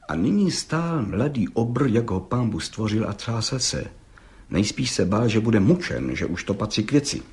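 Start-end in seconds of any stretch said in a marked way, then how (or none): none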